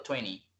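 "twenty" as twenny, second t dropped. Shown as a demonstration. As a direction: say "twenty" without the t after n. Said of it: "para decirlo más rápido".

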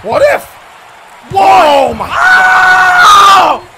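very high, loud outburst; shocked screaming. Real, not acted. Commentator stunned by the match, shocked screaming.

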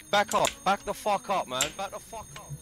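ordinary talk, nothing marked

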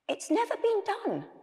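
high-pitched, anxious little character; worried voice. A narrator's reading. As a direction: fret in a small high voice. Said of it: "said a worried voice".

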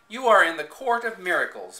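loud, commanding boom. A narrator's reading. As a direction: announce it to fill the hall. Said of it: "boomed a voice behind me".

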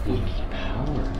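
low, slow ghostly echo; disguised deep voice. Pretend. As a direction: drag it out like a ghost. Echoing the spirit box voice.

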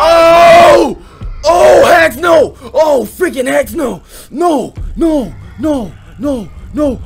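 panicked shouts of no, high and loud; loud frightened shrieking; terrified screaming. Real, not acted. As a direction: scream in real fright as it lunges. Monster jumps out, real fright.

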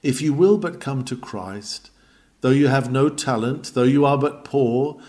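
normal talking voice

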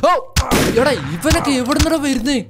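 high, loud startled outburst; panicked shout. Real, not acted. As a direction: shout in shock at the ambush. Ambushed by creature.

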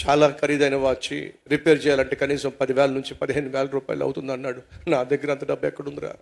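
low, soft, helpless explanation; subdued, defeated pleading; meek excuse. Acted. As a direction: explain timidly to an angry friend. Culprit pleads he has no money.

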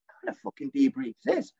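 high incredulous question; baffled disbelief. Retold or imitated. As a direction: replay your confusion.

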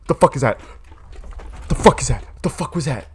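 alarmed repeated outburst; startled alarm. Real, not acted. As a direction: blurt it out in fright. Startled in game.

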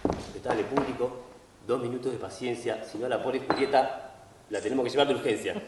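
lightly voiced actor addressing the house; stage apology.

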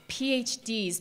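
usual speaking voice

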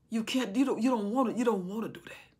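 usual speaking voice

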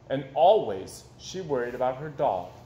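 ordinary delivery

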